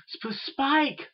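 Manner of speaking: high, stammered cry for help; urgent shout. A narrator's reading. Twilight shouting to her helper as magic goes wrong, urgent shout. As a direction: call out in alarm, voice jumping high. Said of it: "Twilight shouted".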